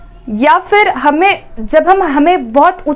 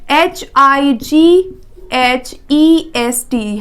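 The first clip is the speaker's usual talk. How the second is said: slow spelling out; much slower than usual. Shown as a demonstration. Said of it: "H I जी H के साथ".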